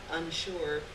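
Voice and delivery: plain reading voice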